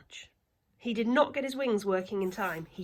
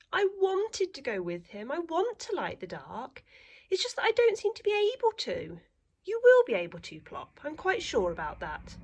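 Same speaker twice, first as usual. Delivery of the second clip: little owl; 10 semitones higher, 4 dB louder than usual. Acted. Plop and mother voiced.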